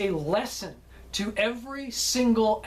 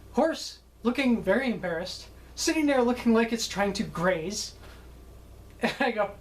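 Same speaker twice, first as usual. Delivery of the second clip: ordinary delivery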